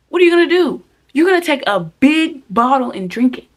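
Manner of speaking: loud, high mock exasperation. Warning viewers about the bottle, loud mock alarm. Dramatizing bad drinking habit.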